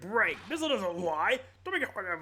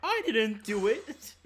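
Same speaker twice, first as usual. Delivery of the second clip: laughing denial; 4 semitones higher, 6 dB louder, much slower than usual. Acted. Warden denies, laughing.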